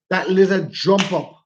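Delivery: ordinary delivery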